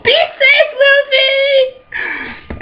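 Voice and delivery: high wailing shout, sobbing; crying farewell. Retold or imitated. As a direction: wail it out in tears. Reader voices Bepo crying farewell.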